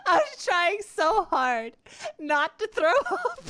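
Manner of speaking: giggling at her own disgust; laughing while talking. Real, not acted. Streamer telling chat about the kiss, laughing while speaking.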